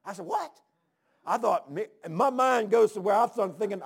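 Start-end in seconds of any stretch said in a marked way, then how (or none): 0.2-0.6 high, startled one-word question; incredulous. Retold or imitated. Preacher retelling his bafflement at a refusal, incredulous. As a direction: squeak it up high in disbelief.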